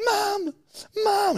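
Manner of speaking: put-on very high whiny kid voice; child calling. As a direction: call for mum like a child.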